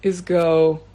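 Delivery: usual speaking voice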